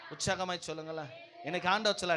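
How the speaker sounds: ordinary delivery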